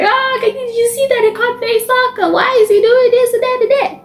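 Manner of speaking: very high-pitched girlish chatter, acted out; high, playful mock whining; playful gushing. Leaning on friend, chattering at match.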